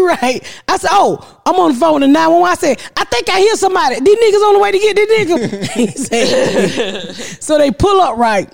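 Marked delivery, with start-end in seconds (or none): 0.9-5.7 fast, high chatter; excited rush. Retold or imitated. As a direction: hurry it out, pitch up. Retold excited phone talk.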